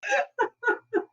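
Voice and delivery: bursts into a high, embarrassed laugh; high delighted laugh. Real, not acted. Teased about Ab Fab, she laughs high and embarrassed.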